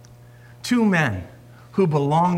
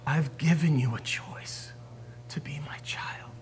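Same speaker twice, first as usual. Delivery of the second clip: much softer, lower, pleading whisper; tender whisper; 4 semitones lower, 10 dB quieter than usual. Acted. Preacher voicing God inviting the listener, whispering. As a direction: ask it gently, almost breathed.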